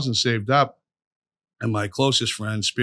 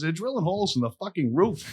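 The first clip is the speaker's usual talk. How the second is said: excited warning; 7 semitones higher than usual. Retold or imitated. Quoting the excited roofer kid.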